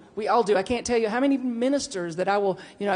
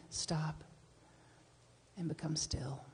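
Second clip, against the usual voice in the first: whispered stillness; 3 semitones lower, 14 dB quieter, slower than usual. Acted.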